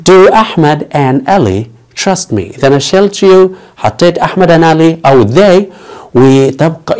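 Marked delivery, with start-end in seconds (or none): 0.1-2.4 very slow and spaced; slow teaching read. Shown as a demonstration. Teacher models the question with names slowly. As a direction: give each name room, slowly. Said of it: "هل أحمد وعلي يثقون فيني؟".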